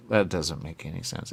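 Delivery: ordinary talk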